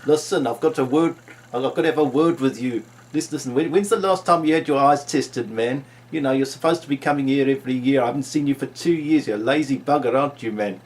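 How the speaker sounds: put-on loud, scolding South African accent. South African optician berating an overdue patient, loud South African accent. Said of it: "one of my many South African doctors".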